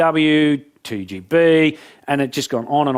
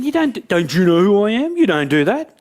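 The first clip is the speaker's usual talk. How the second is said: high, raised indignant protest; indignant outrage; 7 semitones higher, 4 dB louder than usual. Acted. Dropped speaker fuming at the caller, indignant. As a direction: play the affronted star, pitched well up.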